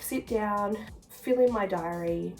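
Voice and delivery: ordinary talk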